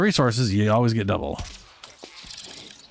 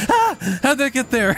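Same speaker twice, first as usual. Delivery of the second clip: high startled cry; jump-scared shriek; startled yelp; 13 semitones higher than usual. Retold or imitated.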